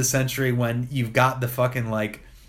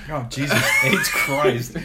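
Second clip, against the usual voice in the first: slow, laughing shout of disbelief; laughing exclamation. Real, not acted. Laughing reaction to joke.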